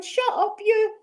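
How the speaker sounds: high, dismissive snap; snapping at inner voice. Retold or imitated. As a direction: shut the nagging voice down. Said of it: "I'll be going".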